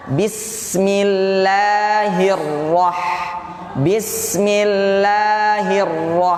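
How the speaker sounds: slow "Bismillahirroh" with a heavy, full roh; thick roh. Shown as a demonstration. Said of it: "Jadi bacanya". ("Bismillahirroh" dengan roh tebal)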